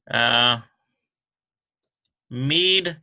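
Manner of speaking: usual speaking voice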